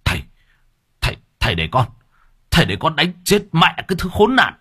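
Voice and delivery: high, fast furious demand; hissed rage. Acted. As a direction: beg to beat him, raging. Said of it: "nó rít lên".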